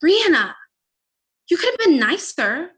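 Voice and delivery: loud, high reproach of Brianna; mock scolding critic. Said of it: "And that's why".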